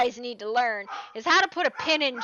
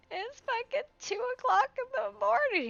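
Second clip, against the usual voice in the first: high mock Gloria; 12 semitones higher than usual. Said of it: "Gloria's like".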